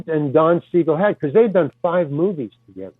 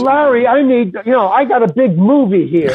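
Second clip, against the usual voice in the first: raised, pushy director voice; loud director pitch; 6 semitones higher, 4 dB louder than usual. Retold or imitated. Director pressing the actor, loud and pushy. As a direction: sell the big movie, loud and high.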